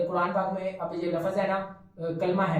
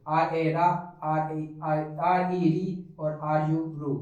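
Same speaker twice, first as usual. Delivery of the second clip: slow spelling demo; 2 semitones lower, much slower than usual. Shown as a demonstration. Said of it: "आर लेते हैं इस तरफ से".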